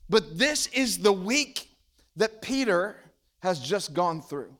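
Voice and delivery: ordinary talk, nothing marked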